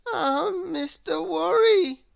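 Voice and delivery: high, slow, teary answer; woeful high voice; fretful Mister Worry. Acted. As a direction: answer close to crying.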